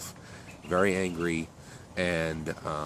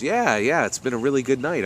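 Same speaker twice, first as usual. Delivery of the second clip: higher and louder, bubbling over with good news; excited; 7 semitones higher, 6 dB louder than usual. Acted. Sarah answering boss Rita about her big tip, excited. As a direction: gush happily about the great night.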